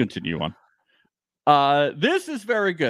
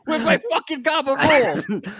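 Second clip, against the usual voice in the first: high angry mobster demand; Sopranos accent; 9 semitones higher, 3 dB louder than usual. Pretend. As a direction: bark it like Tony Soprano. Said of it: "Tony Sopranos".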